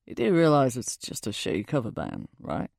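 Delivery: put-on low British-accented stranger. A narrator's reading. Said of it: "He has this British accent".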